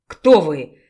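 low, loud growl of a wolf; growled demand. A narrator's reading. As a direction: bark it out low and hard. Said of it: "зарычал альфа".

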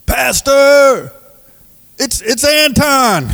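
put-on very high, loud slurred drunk voice; calling up to the pastor like a stumbling drunk; drunk man calling out. Drunk man calling to the pastor on milk crates, high and slurred. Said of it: "he's just like".

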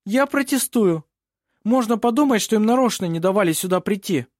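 high-pitched heated protest; indignant objection. A narrator's reading. Lawyer protests to the judge, indignant. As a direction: object as if affronted.

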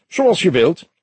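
high, put-on voice of an affronted chief; affected high reply; affected commissaris. A narrator's reading. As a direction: concede stiffly, pitched high.

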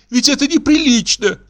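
high-pitched, distraught objection; acted tearful outrage; tearful protest. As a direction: plead indignantly in a high woman's voice. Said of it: "зарыдала Каролина".

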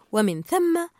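usual speaking voice

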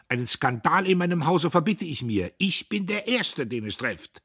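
high, loud, outraged; pompous rage. A narrator's reading. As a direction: forbid it pompously. Diederich rants about his honour.

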